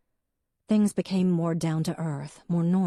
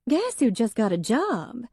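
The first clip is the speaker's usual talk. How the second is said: higher, bright, eager announcement; wife proudly sharing her news; excited; 8 semitones higher, 4 dB louder than usual. A narrator's reading. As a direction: burst in with good news. Wife returns delighted.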